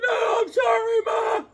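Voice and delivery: put-on very high, wailing child's plea; hammed-up sobbing apology, drawn out; mock bawling. Crying kid apologizes to mom, mock bawling.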